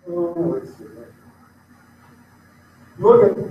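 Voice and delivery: ordinary delivery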